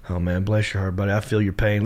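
low, soft commiseration; sincere sympathy. Acted. Southerner consoling a buddy who is hurting, sincerely sympathetic. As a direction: console a pal, gently and low. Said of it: "in a good way".

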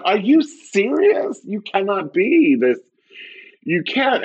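high, drawn-out disbelief; exaggerated incredulity. Comedian reacting to Karens, high comic incredulity. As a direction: pitch it way up, appalled and slow.

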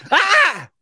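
high mock yelp of pain; retold yelp. Helper getting shocked, a high mock yelp. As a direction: yelp like you just got zapped.

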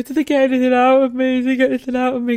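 high, frantic, tearful pleading; retelling her panicked, tearful plea after the operation; panicked crying. Retold or imitated. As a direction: beg for an answer through tears. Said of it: "I was already crying and I was going".

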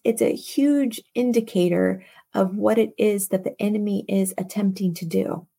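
ordinary talk

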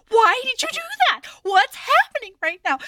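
high, loud alarmed questions; retold panic. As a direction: fire it out in a mock panic. Retold panic after pepper spray.

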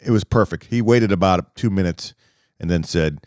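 normal talking voice

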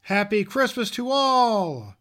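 Santa's ringing goodbye dying to a hush; exclaimed farewell. Acted. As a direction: shout it as he drives away, then let it fade. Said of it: "I heard him exclaim".

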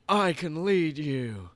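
slow, low, mock-dramatic leader; Nicolas Cage. Nicolas Cage appearing before the babies, slow, low impression. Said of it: "then they just hear".

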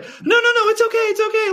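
high, quick soothing no no no; hushing reassurance. Retold or imitated. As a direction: shush a crying child gently. Dad voicing urge to soothe.

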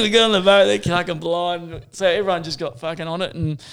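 normal talking voice

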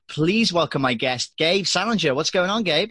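upbeat announcer intro, first take; host introduction. Acted. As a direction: introduce the guest with energy. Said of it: "let me re-record that bit".

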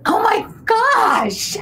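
a real gasp of disbelief turned into a loud cry; stunned outcry. Real, not acted. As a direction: shout it out high and loud, slowly, half crying. Host stunned by Tyler Perry reveal.

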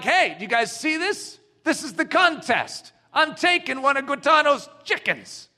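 high, loud taunt of a village witch doctor; taunting challenge. Acted.

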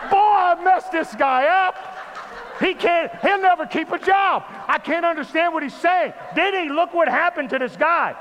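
high, loud mock alarm; frantic comic outburst; panicked shouting. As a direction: yell as if you just broke someone. Acts out panic at tongues.

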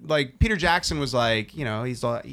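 usual speaking voice

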